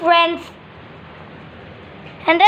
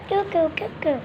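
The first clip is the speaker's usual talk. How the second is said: put-on cooing baby; 8 dB quieter, faster than usual. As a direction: coo like a pretend newborn. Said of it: "newborn little sister".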